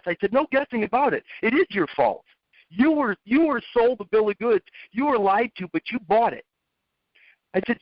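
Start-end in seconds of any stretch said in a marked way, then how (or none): 0.3-6.5 higher, firm scolding; retold stern telling-off; stern lecture. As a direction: lay the blame on him, mildly acted. Lecturing kid about fault.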